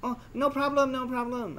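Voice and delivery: high put-on voice of the girl; mock bar girl. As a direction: lightly mimic her breezy brush-off. Mimicking the bar girl's reply.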